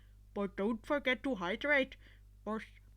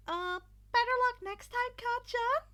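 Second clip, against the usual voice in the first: higher, sheepish awkward voice; nervous grimace; 11 semitones higher, 7 dB louder than usual. Acted. Izuku nervously teasing the losing rival, sheepish. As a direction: taunt weakly, wincing. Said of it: "Izuku grimaced".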